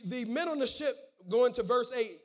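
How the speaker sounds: ordinary talk, nothing marked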